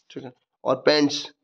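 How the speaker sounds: ordinary delivery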